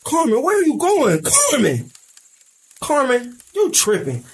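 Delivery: calling out. Acted. Calling after fleeing girl.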